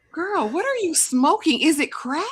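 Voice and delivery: high-pitched disbelieving questions; mock incredulous. Grilling the girl about her confession, mock incredulous.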